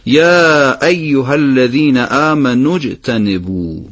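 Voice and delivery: slow, آمنو with a short vowel running straight into اجتنبو; short vowel joined. Shown as a demonstration. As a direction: clip آمنو and join it to اجتنبو slowly. (آمنو کوتاه و چسبیده به اجتنبو)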